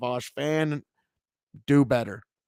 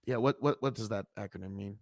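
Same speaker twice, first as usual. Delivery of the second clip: usual speaking voice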